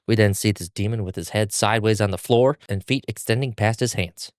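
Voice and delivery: ordinary delivery, nothing marked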